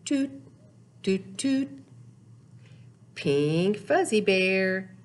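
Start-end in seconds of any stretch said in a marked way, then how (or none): none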